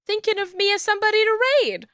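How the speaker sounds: high, fast, effusive gratitude; bubbly thanks. Real, not acted. Streamer thanking a raider for the raid, high and bubbly.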